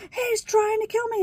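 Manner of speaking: high, loud hammed-up emergency plea; mock panic. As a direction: imitate a hysterical caller accusing someone. Mother's staged emergency call.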